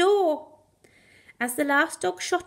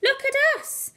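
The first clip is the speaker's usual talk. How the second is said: much higher, giddy "Look at us"; overexcited; 13 semitones higher than usual. Acted. Young hound whirling the terrier, overexcited and high. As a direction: show off like a puppy spinning. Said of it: "said an overexcited young hound".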